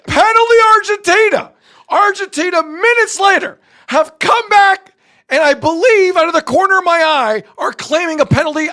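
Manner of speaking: high shouted opening, easing into punchy commentary; excited play-by-play. Real, not acted. Sportscaster calling a late penalty claim, excited play-by-play. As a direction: call a dramatic penalty live, full of urgency.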